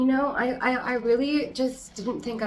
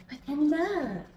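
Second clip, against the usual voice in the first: much higher, gushing delight; thrilled; 8 semitones higher than usual. Real, not acted. Thrilled by new leaf.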